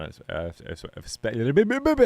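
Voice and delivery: stuttering on the first syllable, pitch jumping up; real stumbling false starts; tongue-tied stammer. Real, not acted. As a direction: get badly stuck starting the word. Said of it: "Did you just have a stroke?".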